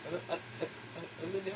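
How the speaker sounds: hushed puzzled listener voice; confused whisper. Retold or imitated. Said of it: "people on the podcast are like".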